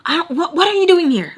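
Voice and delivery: high, stammering outrage; mad and disgusted. Retold or imitated. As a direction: splutter it in disgusted disbelief. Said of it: "so mad, so, like, uh, like, disgusted".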